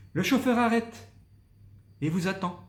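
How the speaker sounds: high "le chauffeur arrête" falling on "et vous attend"; rise then fall. Shown as a demonstration. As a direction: climb then descend. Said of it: "j'ai souligné en montant, en descendant".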